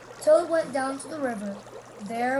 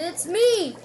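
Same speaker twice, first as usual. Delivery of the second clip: raised shout across the river; 5 semitones higher, 6 dB louder than usual. A narrator's reading. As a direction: call loudly to be heard far off. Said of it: "he shouted".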